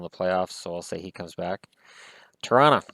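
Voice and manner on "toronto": "Toronto" said fast as "Tronna", dropping the middle t; "Toronto" the way a Newfoundland dad said it, "Tronna". Shown as a demonstration. Fan honouring his late dad's way of saying it, "Tronna". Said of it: "Toronto's how it was pronounced by, by my dad from Newfoundland".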